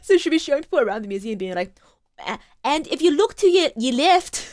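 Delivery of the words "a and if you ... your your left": raised, louder mock Irish lilt; pretend Irish accent. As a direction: imitate a tour guide faking an Irish accent. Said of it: "pretend this is an Irish accent".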